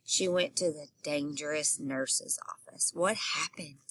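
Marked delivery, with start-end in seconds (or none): 2.9-3.8 whispered, soft question to the class; hushed question. Real, not acted. Teacher whispering to listening children, hushed. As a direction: lean in and whisper it. Reader asks kids in hushed voice.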